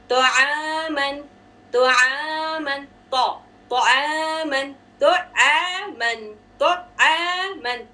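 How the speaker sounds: slow drilled तो आमन with heavy तो; slow recitation drill; heavy taw, light ain. Shown as a demonstration. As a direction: say तो आमन slowly, तो full, आमन light. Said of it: "तो heavy है".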